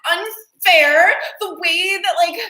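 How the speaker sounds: high, loud, hammed-up pleading; whiny mock indignation. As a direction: lecture as an aggrieved whiner, near mock tears. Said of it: "it just comes off so whiny".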